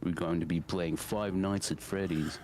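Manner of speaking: low-pitched YouTuber intro impression; Markiplier. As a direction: drop the voice for a streamer greeting. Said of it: "drop it like".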